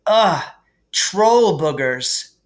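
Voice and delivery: loud, drawn-out groan of disgust. A narrator's reading. Harry wipes troll boogers off his wand, disgusted. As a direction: recoil at the slime on the wand.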